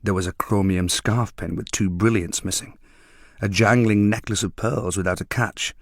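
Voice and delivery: plain reading voice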